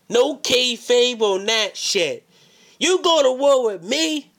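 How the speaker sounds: slow, loud, high menacing shout. Acted. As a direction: bellow the threat slowly. Promo threat.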